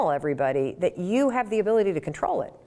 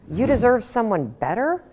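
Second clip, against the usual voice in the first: louder and higher, a disbelieving repeat with a rising end; louder mocking echo of "You deserve someone better?"; incredulous; 5 semitones higher, 5 dB louder than usual. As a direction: throw the ex's words back in disbelief. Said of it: "You want to scream".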